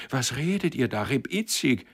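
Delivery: put-on upset young man demanding an explanation; dismayed. A narrator's reading. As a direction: ask in bewildered dismay. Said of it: "rief Sender bestürzt".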